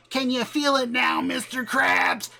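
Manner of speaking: high-pitched taunting cartoon-quote chant; annoying kid ghost. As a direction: pester them with a high, obnoxious meme quote. Mimics annoying kid haunting someone.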